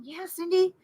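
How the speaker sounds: hushed friend's reply. Retold or imitated. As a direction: imitate a friend's quiet, patient confirmation.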